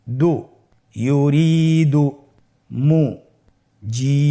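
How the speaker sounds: slow, drawn-out syllables with pauses between. Shown as a demonstration. Teacher reads without spelling for learners, broken syllable-by-syllable recitation. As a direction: break the word up, then join it, holding long vowels. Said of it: "ভেঙে ভেঙে পড়ব".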